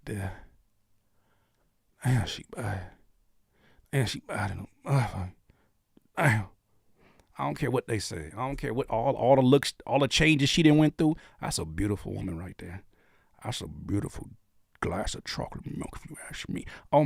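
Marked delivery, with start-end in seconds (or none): 0.0-6.5 low, soft, spaced-out awe; hushed admiring mutter. Retold or imitated. Retelling himself drooling at the screen, hushed awe. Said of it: "I was like". 11.4-16.7 low, soft, fading to a whisper; smitten murmur. Real, not acted. Host admiring a beautiful woman, hushed to a whisper.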